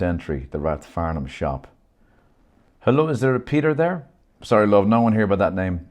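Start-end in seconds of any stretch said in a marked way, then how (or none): 2.8-4.1 high, louder Indian woman's voice; Shri's voice. Acted. Shri voiced on phone. 4.4-5.9 a louder Irish shop clerk. Acted.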